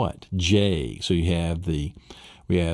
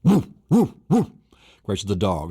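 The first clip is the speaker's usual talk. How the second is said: mock cat scratch; much slower than usual.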